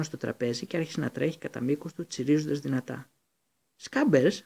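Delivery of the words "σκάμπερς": high, loud surprised cry; exclaiming. A narrator's reading. Ron finds his lost rat, surprised exclamation. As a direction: cry out the rat's name in shock. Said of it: "αναφώνησε ο Ρον".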